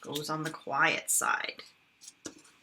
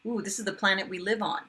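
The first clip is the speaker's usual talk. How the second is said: usual speaking voice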